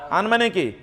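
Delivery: normal talking voice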